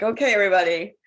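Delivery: loud, sing-song okay everybody; mock teacher. Friend imagining Melanie reading to the group, loud and sing-song. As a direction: herd a room of kids. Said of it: "Melanie or Amy being like".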